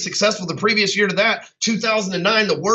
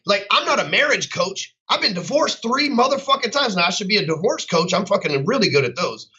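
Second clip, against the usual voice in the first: normal talking voice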